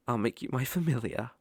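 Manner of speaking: ordinary talk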